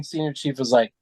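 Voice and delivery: ordinary talk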